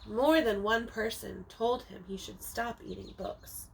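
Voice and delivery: ordinary delivery, nothing marked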